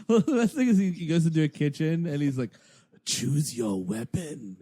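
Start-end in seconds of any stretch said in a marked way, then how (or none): 3.0-4.6 low, drawn-out sinister command; slow villain voice. Retold or imitated. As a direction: purr it like a horror host. Voicing Trickster in game.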